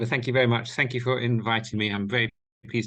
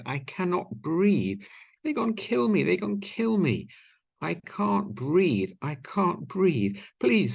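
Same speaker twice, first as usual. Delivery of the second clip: desperate pleading, pitched much higher and slow on each "I can't breathe"; George Floyd's last words; 8 semitones higher, slower than usual. Retold or imitated. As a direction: beg for breath and mercy, voice straining upward. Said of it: "the exact words of George Floyd".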